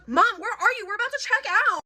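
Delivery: high, whiny demand to mom; rude child imitation. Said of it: "In that exact tone".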